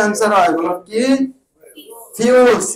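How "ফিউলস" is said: ফিউলস as "fyools", higher and falling; English pronunciation. Shown as a demonstration. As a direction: model ফিউলস with a fyoo glide. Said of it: "এই উচ্চারণটা মনে রাখবা". (ফিউলস, ইংরেজি ধাঁচে ফিউ-লস উচ্চারণ)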